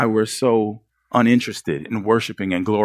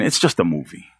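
lower, dismissive mock excuse voice; muttered, lower imitation of a lame excuse; imitating excuses; 7 semitones lower than usual. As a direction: shrug it off like a self-justifier. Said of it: "you know, saying".

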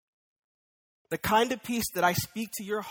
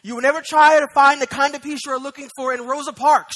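much louder, higher, driving pulpit voice; full force preaching; 6 semitones higher, 12 dB louder than usual. Acted. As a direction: hammer it home to the congregation.

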